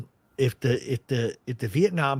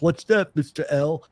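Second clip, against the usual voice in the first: imitated Andy; 3 semitones higher than usual. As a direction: lightly voice an unsuspecting Andy. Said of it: "He goes".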